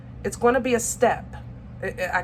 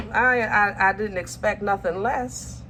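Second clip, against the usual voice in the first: usual speaking voice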